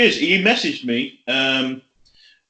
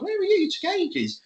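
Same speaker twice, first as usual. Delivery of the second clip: high, soft mock missus; lightly voiced nagging girlfriend; 7 dB quieter than usual.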